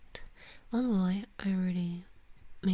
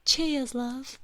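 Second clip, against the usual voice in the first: put-on louder, higher-pitched British accent; 5 semitones higher, 6 dB louder than usual. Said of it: "I'm terrible at doing accents".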